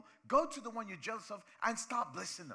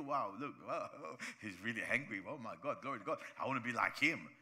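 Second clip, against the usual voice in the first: low, lightly mocking gushing admirer; a hint of a sarcastic fan, lower pitch; 8 semitones lower than usual. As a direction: fake awe, deep and sarcastic. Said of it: "we don't look at you and feel".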